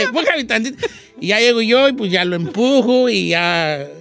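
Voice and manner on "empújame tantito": higher, comic begging; hammed-up drunken request; drunk on a swing. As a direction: beg for a push like a drunk. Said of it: "Era en los columpios un borracho".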